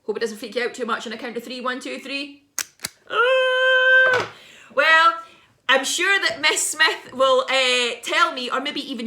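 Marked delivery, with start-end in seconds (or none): 3.1-4.3 very high, loud shriek; scream. Pretend. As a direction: let out a freaky scream. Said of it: "Do you want to hear that noise again?".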